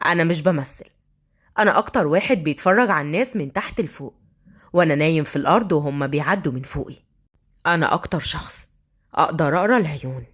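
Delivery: low, deep-set man's voice; brooding street thug. Acted. Thug describing himself to the girl, low brooding voice. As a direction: speak from down low, self-assured.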